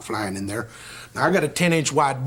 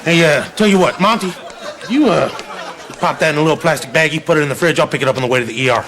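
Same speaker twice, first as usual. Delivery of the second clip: loud, strained in pain; 2 semitones higher, 6 dB louder than usual. Acted. Injured, asks Monty for help.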